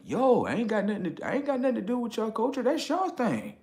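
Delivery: very high, fast mocking quote. Voicing someone denying the culture, high mock voice. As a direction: mimic a squeaky disclaimer. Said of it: "something that's like".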